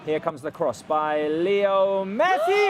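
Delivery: slow build to a drawn-out name; commentator's rising call on the cross; excited play-by-play. Real, not acted. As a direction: stretch it out, climbing to a peak.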